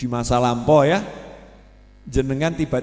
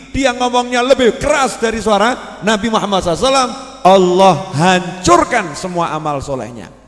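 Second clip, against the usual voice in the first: loud, high, forceful sermon warning; stern preaching; 8 semitones higher, 7 dB louder than usual. Acted. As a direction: thunder the warning at the crowd.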